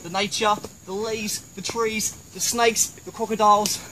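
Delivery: put-on quiet Australian accent; soft Aussie nature presenter. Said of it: "why do you speak in an Australian accent".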